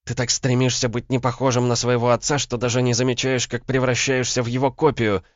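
high-pitched female accusation; cutting reproach. A narrator's reading. Woman likening man to his father, cutting.

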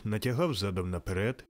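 usual speaking voice

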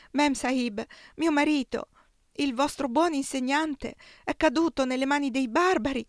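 high, loud terrified pleading; frightened servant begging her mistress; anguished shriek. A narrator's reading. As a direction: beg for help in anguish. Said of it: "strillò in preda all'angoscia".

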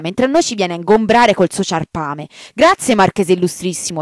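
loud, mocking bow to the absent marquis; sarcastic rant. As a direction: drip with bitter, angry sarcasm. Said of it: "si inchinò polemicamente".

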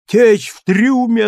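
high, loud and slow sailor's alarm; deranged old man. A narrator's reading. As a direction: shout it as if the ship is sinking. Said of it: "явно обезумев".

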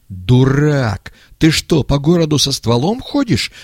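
angry yell. A narrator's reading. Old man scolding the young man over the gun, yelling. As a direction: bawl out a reckless accomplice. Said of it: "заорал старик".

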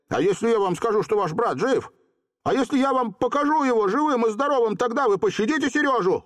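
put-on very high, speeding boy's plea; urgent bargaining. A narrator's reading. Volka pleading for Seryozha's life, urgent. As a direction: rush the offer eagerly, rising in pitch.

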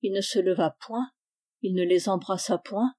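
ordinary delivery, nothing marked

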